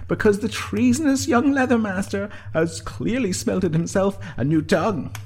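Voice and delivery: high, loud mock proclamation; calling out theatrically. A narrator's reading. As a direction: call it out high, stretching the words. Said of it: "called the Ard Slanaha".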